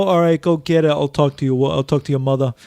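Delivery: lightly voiced New York accent; a hint of a New York dad. Voicing Hawkeye to a co-host in a light New York accent. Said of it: "I'm giving him a New York accent".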